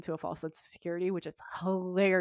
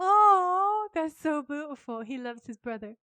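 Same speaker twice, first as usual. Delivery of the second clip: mock gushing fan; 6 semitones higher, 5 dB louder than usual.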